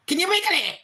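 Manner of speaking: put-on high, wide-eyed little kid asking; high curious kid voice; childlike question. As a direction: ask it like a curious small child. Child self quoted to dad.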